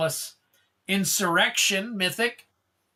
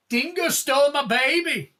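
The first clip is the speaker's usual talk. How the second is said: high, hammed-up Australian quip, a bit louder; mock Australian accent; 7 semitones higher, 3 dB louder than usual. Reading out a card name as a joke, mock Australian accent. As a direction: toss off the line in a jokey Australian accent.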